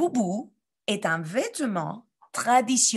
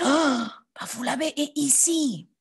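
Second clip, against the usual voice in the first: high gasp of discovery; mock surprise; 5 semitones higher than usual. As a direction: act amazed for the kids. Gasps finding it on map. (surprise jouée)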